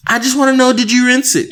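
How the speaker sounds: a hint of a nagging spouse; mocking partner. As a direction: ape her insistent question. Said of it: "And he was like".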